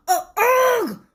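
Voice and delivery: high crying ugh sounds; pained tooth moan. Acted. Tooth moans as Stuart brushes, high crying groans. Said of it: "moaned the tooth".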